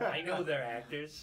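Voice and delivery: slow, loud line broken by laughter; can't keep a straight face as the paranoid guy; laughing mock paranoia. Friend plays the paranoid one, cracking up. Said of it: "paranoid friend bit".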